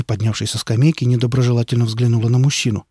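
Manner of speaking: ordinary delivery, nothing marked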